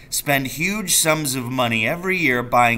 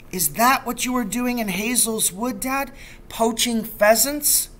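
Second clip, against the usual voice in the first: put-on boy's voice; 10 semitones higher than usual. Boy questions father about poaching.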